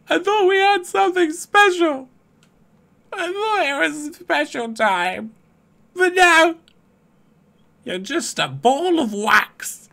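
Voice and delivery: high, hammed-up wounded pleading; mock hurt. Streamer pretending teammate Rose broke his heart, high mock-wounded plea. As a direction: play the jilted friend, high and whiny.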